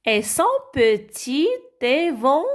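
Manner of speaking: ÉVENTAIL broken into "évan-ta-i-le"; wrong split reading. Shown as a demonstration. Teacher misreads the phrase for children, letter by letter. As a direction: split the end of ÉVENTAIL, sounding each letter and the L. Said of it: "Non plus".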